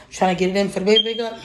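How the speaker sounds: winded and rushing fast; out of breath. Real, not acted. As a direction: still catching her breath. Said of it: "I'm out of breath".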